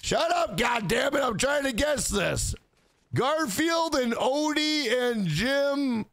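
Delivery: high-pitched, hammed-up exasperated operator; distracted sex operator. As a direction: call out quiz guesses, mock-snapping at the caller. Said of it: "and she's like".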